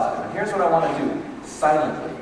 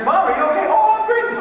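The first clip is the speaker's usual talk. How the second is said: teary mom; 5 dB louder than usual. Retold or imitated. Mom crying, son asks.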